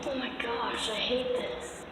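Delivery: frightened whisper; barely voiced, scared; nervous night vlog whisper. Real, not acted. Creeped out in tunnel.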